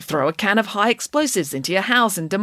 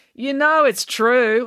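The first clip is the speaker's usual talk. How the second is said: louder, slow cheeky heckle; heckling; 8 semitones higher, 5 dB louder, much slower than usual. A narrator's reading. Animesh heckling Mister Lang, cheeky. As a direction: needle the teacher from the back. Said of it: "heckled Animesh".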